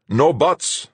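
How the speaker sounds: loud, high snap; captain snapping at crewman; snapped. A narrator's reading. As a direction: cut him off hard. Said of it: "Fuchs snapped".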